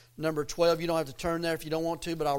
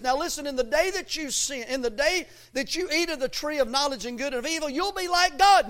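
higher-pitched preacher's devil voice; devil tempting man; 8 semitones higher, 4 dB louder than usual. Acted. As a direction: sell the lie as the tempter, climbing at the end. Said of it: "he lies to man, and he says".

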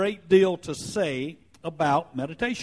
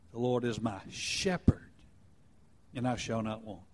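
one word in "The Lord is my shepherd" leaned on, rest soft; quiet reflective reading with a single weighted word; stress shifted; 6 semitones lower, 7 dB quieter than usual. Shown as a demonstration. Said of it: "emphasizing a different word each time".

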